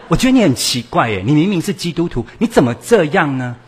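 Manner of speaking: high, loud, exasperated scolding with a laugh in it; child scolding mom. Acted. A teenager loudly scolds his mother for haggling. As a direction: lay into her like a fed-up teenager.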